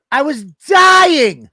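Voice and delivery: loud, high, stretched-out protest; exasperated outburst, leaning long on "dying"; indignant yell. Retold or imitated. Husband protesting to wife about being ignored, indignant.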